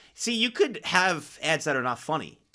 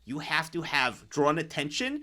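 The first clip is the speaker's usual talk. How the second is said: usual speaking voice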